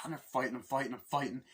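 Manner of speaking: ordinary talk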